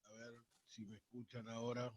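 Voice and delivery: faint and distant, far from the mic; phone held away. Real, not acted. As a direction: still too far from the phone. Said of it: "sí, un poquito bajito".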